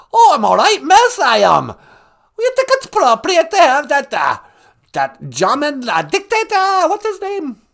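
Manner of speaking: loud, high, scolding caller; indignant high-pitched royal caricature; Queen Elizabeth. Acted. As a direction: scold in a piercing put-on falsetto. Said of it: "Hey, Queen Elizabeth".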